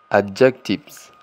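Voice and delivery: adjectives said slowly in English; slow English model of adjectives; English word adjectives. Shown as a demonstration. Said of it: "continuamos con los adjetivos". (pronunciación lenta en inglés de adjectives)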